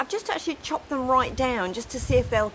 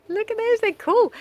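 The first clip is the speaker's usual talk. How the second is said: high excited; 13 semitones higher, 4 dB louder than usual. Real, not acted. Showing off street treasure.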